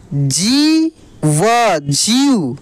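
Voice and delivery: very slow जी व, then joined जीव; drawn-out spelling out. Shown as a demonstration. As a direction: hold जी, add व, blend into जीव. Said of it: "खींच कर किया जाता है". (जी व जीव, खींच कर)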